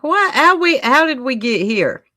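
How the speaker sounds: a bewildered how-did-we-get-here; mock surprise. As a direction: squeal it out in disbelief. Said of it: "I'm like".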